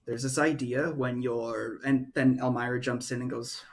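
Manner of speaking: usual speaking voice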